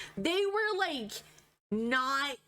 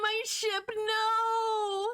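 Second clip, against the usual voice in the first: dismayed cry; 8 semitones higher, much slower than usual. Real, not acted. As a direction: cry out at the loss. Reacting to game loss.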